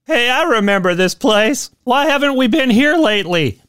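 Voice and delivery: high, carefree "Hey" of a wandering dead man; cheerful dead man. Pretend. Dead man back from the grave, chirpy and clueless. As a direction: play the clueless ghost.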